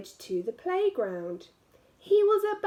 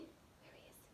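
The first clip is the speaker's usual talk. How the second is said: whisper; 37 dB quieter than usual. Real, not acted. Spotting the badger, hushed aside.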